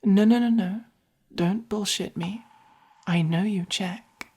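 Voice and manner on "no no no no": louder, higher cut-in; firm objection. Acted. Buyer refusing the seller's evasion, firm objection. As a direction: cut them off with rapid, insistent refusals.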